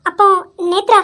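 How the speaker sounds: ordinary talk, nothing marked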